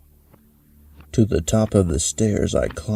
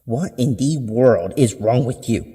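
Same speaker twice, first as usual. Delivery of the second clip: fuming mother, pitched a bit higher; gritted-teeth anger; 4 semitones higher than usual. Acted. Mother finds the broken plate, scolds through gritted teeth. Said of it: "she asked through gritted teeth".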